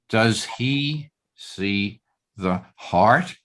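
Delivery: slow question rising onto "heart"; asking what it is that he sees; stress on heart. Shown as a demonstration. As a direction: draw it out slowly and lift "heart". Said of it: "upon which word you place the emphasis".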